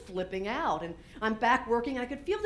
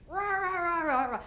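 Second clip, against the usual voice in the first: mock yelling; 7 semitones higher than usual. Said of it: "I'm, like, yelling at Ernie".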